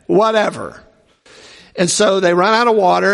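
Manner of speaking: normal talking voice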